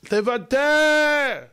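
high, long drawn-out call; emphatic call. Acted. Lecturer urging a husband to give in, drawn-out loud call. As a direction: hold the last vowel, high and sustained.

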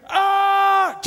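high, loud comic attack cry; hammed-up war cry; battle scream. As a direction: scream as you charge with a shovel. Attacking snake with shovel.

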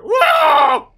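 loud high startled whoa. Retold or imitated. Imitating a guy grabbed in the game, startled. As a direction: yelp it like you got grabbed. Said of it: "He was like".

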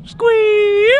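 high squirrel squeak; excited squeal. Pretend.

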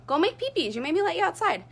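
high, sing-song voice for the dog; talking to the dog. Real, not acted. Owner talking to her dog while filming, in a high baby voice.

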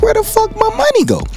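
spoken high-pitched disbelieving cry; high yelp of disbelief at the empty drawer; shocked outburst. Retold or imitated. Cash missing, retold outrage.

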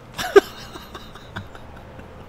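high-pitched breathy laugh; laughing at how the friendship changed. Real, not acted. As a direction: burst out laughing. Laughs at the outcome.

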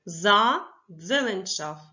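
"задзеленчав" drawn out very slowly, each syllable apart, "дз" kept together. Shown as a demonstration. Teacher showing pupils how to split "задзеленчав", slowly syllable by syllable. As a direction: spell out "задзеленчав" in slow separate syllables. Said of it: "Наприклад".

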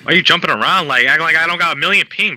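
ordinary delivery

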